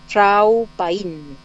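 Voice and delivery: "chau paín" pronounced emphatically for a learner; passing greeting demonstrated. Shown as a demonstration. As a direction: model "chau paín" with a firm first word. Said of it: "hay otro saludo que es más al pasar".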